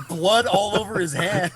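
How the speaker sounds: ordinary delivery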